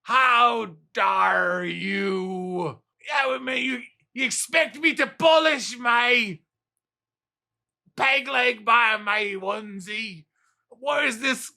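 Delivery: hammed-up British pirate accent, drifting. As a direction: bellow like a peg-legged old British pirate. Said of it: "a pirate with a hat on and a peg leg walking in".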